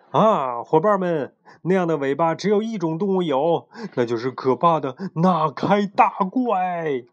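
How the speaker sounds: high, loud, hammed-up warning; dramatic storyteller sailor. A narrator's reading. Crewmate warns his mates of a monster, dramatic. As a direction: announce the monster with big dramatic relish. Character announces a dreaded monster.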